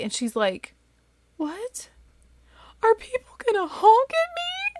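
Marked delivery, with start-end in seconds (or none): 1.4-4.8 small high "what", then a squeaked question; horrified child. Retold or imitated. Daughter learning people may honk, horrified high child voice. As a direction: imitate an appalled kid, pitch climbing very high.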